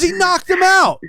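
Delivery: very high excited holler; hammed-up high yell of disbelief; retold ecstatic yell. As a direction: imitate a friend screaming big news. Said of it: "He goes".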